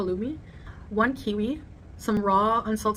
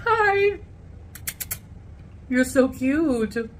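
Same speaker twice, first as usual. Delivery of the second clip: cooing greeting; 6 semitones higher, much slower than usual. Real, not acted. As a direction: coo at a cute pet. Greets someone cute off camera.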